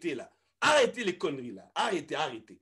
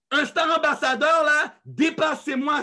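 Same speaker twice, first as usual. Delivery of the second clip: raised, high-pitched rallying plea; loud urging; 5 semitones higher, 6 dB louder than usual. Real, not acted. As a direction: call out loudly to spur them on. Supplie bruyamment son équipe. (exhortation forte et insistante)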